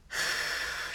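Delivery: breathy sigh after crying; soft shaky sigh. Real, not acted. Mom calming herself after crying on camera, soft sigh. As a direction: let out a soft, steadying sigh. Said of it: "Okay, I'm sorry I cried".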